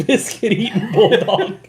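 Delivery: loud, high, slow through laughter; laughing. Real, not acted. Friend repeating an odd insult, laughing hard. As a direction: crack up while repeating the words.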